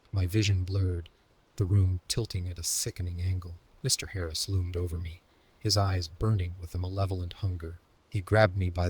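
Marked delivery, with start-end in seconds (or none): none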